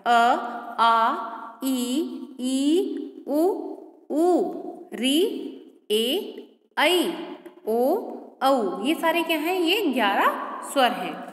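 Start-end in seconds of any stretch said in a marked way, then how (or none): 0.1-8.8 each vowel said slowly and clearly, one by one; reciting the vowels. Shown as a demonstration. Teacher reciting the Hindi vowels to the class. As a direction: call out the vowels like a teacher at the board.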